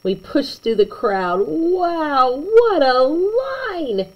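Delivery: plain reading voice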